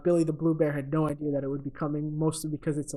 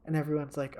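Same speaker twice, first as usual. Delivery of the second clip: usual speaking voice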